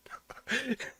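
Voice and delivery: short high burst of laughter; a quick disbelieving laugh. Real, not acted. Streamer laughing at the clip's logic, high laugh.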